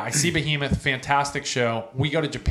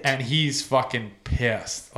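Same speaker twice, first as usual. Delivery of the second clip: usual speaking voice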